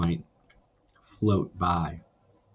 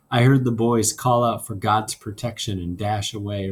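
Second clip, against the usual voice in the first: ordinary delivery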